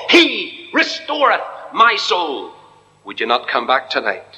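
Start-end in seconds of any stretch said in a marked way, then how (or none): 0.1-2.5 slow solemn scripture quote. Real, not acted. Preacher quotes the psalm again.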